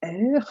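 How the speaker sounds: "R" as "air" with a guttural end; French letter name "R", rasped in the throat. Shown as a demonstration. Teacher giving the letter R, guttural French sound. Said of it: "The letter R is".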